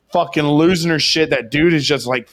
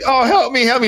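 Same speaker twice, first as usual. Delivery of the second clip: high-pitched mock panic; hammed-up frantic plea; pleading for help; 9 semitones higher, 3 dB louder, faster than usual. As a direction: beg like a man dangling from a balcony. Hanging man begging for help.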